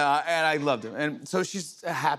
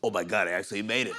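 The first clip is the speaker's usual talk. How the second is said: lobster voice; 3 semitones lower, faster than usual. Pretend. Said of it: "a fifty-six-year-old lobster hiding in the back of the tank going".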